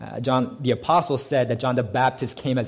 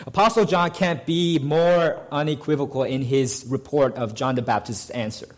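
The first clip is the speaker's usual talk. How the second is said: ordinary talk, nothing marked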